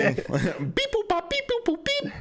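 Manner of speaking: robotic beep boop sounds, high; computer beeping noises. Pretend. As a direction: play a calculating computer. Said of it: "Can you crunch the numbers again?".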